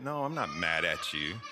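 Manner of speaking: easy, comforting tone; gentle reassurance. Shown as a demonstration. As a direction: reassure them kindly that all is well. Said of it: "when you think about even tone".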